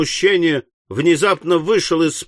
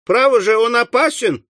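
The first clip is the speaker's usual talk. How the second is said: high-pitched flustered complaint; flustered, indignant outcry; agitated alarm; 9 semitones higher than usual. Acted. Indignant after being jabbed in leg.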